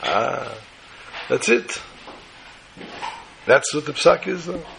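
ordinary delivery

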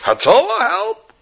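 very high-pitched hammed-up scream; screaming for help. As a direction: mock-scream an emergency call, voice leaping way up. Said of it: "you see the person start screaming".

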